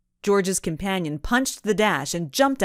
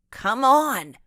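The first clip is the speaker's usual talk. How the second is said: yelling; 7 semitones higher, 4 dB louder than usual. A narrator's reading. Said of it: "she yelled".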